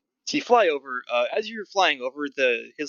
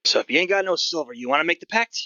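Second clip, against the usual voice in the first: lightly voiced gruff goblin, pitched up; gruff old goblin; 3 semitones higher than usual. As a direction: put on a hint of a crusty old goblin ticket seller. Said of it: "Sort of this gruff old goblin".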